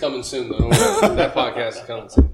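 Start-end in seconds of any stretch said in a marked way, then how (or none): 0.6-1.1 high squeak. Real, not acted.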